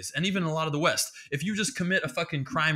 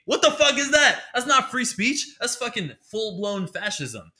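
much louder and higher, indignant; outraged rant; 8 semitones higher, 5 dB louder than usual. Real, not acted. Podcaster ranting about cancel culture, loud and outraged. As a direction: blow up in disbelief.

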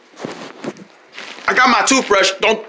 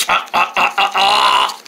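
usual speaking voice

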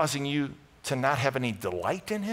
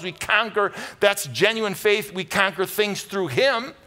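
rising, louder and higher sermon climax; preaching at full force; 6 semitones higher, 5 dB louder than usual. Acted. Pastor rousing the congregation to conquering faith, loud and high. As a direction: preach it out, building to a triumphant cry.

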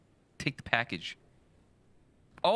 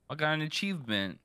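normal talking voice

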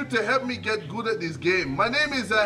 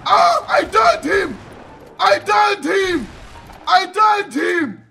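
high, loud, worked-up shouting; combat yelling; 8 semitones higher, 6 dB louder than usual. Real, not acted. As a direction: holler at the enemy mid-fight. Yelling in a game gunfight.

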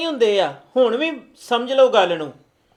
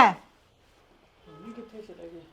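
slow, soft whispered question; dazed whisper; 5 semitones lower, 15 dB quieter, much slower than usual. Acted. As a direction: whisper it, lost in shock. Dazed son murmurs.

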